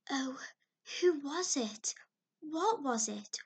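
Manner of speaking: ordinary delivery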